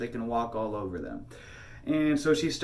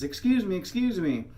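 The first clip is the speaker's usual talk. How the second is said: high-pitched imitation of a woman calling; put-on high voice, insistent; insistent stranger lady; 7 semitones higher than usual. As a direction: pitch it up as a lady demanding attention. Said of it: "all of a sudden she says".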